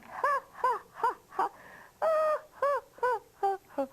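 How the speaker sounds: high-pitched mock laugh, ha by ha; acted bear laugh. Bear laughing at the old witch, high acted ha-has. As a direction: laugh like a naughty storybook bear. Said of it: "he was laughing".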